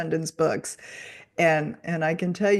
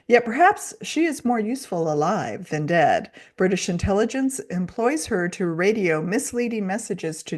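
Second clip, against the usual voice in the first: louder, dramatic blurb reading; back cover read; 5 dB louder than usual. Acted. Cohost reads the back cover for listeners, dramatic read-aloud. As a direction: sell the book like a trailer voice-over.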